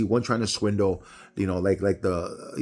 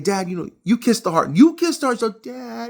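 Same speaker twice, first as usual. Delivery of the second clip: put-on higher, louder little-girl voice teasing dad; little daughter; 13 semitones higher, 5 dB louder than usual. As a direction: play the small daughter insisting. Daughter scolding her dad.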